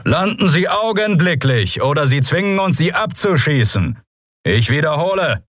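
high, commanding order to land; thundering loudspeaker voice. A narrator's reading. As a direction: issue an official ultimatum over loudspeaker. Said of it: "donnerte eine Stimme über Lautsprecher". (gebieterisches, hohes Ultimatum per Lautsprecher)

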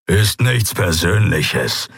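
slow growl; growling beast-man. Acted. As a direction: growl it through bared teeth. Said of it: "knurrte er".

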